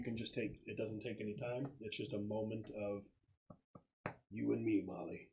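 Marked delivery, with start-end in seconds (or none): none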